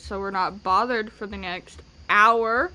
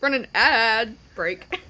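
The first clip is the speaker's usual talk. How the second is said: slow laughing read; 4 dB louder, slower than usual. Real, not acted. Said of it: "And I spelled ad wrong".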